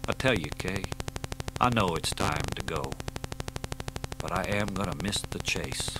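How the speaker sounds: quiet resigned confession to his partner; weary sadness. Acted. Aging Dee, sad confession.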